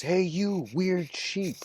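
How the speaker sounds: high, slow, mocking call to the sheep; teasing call. Old tiger teasing the confused young tiger, high slow taunt. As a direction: stretch it out high like taunting a stray.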